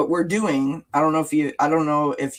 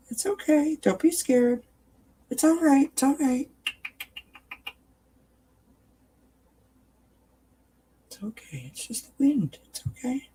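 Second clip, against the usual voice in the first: calm her fear, very high and gentle; 11 semitones higher than usual. Real, not acted. Reassuring frightened pet.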